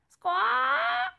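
shrill loud squawk; bird squawk. Acted. Startled bird in the chimney, loud high squawk.

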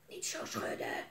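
hushed unvoiced whisper. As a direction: whisper so the parents stay asleep. Kinderen sluipen langs slapende ouders. (fluisterend, zodat papa en mama niet wakker worden)

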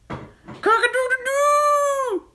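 very high, loud, drawn-out crow; squawking bird. A narrator's reading. Cockatiel after pecking the medicine, long piercing squawk. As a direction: screech it out like a startled rooster. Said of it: "squawked the cockatiel".

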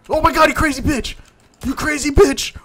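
shrieked insults, high and loud; furious high yells. Real, not acted. Player cursing the cannibal attacking them, shrieking. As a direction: scream abuse at the attacker.

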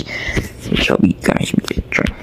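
slow, breathy pleading whisper. Pretend. Voiced cat whispering a plea to the guys. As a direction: beg for help under your breath.

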